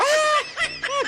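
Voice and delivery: hammed-up high yelps; high mock screams. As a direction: fake screams while thrashing in water. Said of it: "Tas magsisisigaw".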